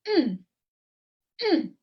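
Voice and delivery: "mm" hummed with a sharp fall from high; fourth tone. Shown as a demonstration. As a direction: drop "mm" down abruptly. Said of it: "Drop down".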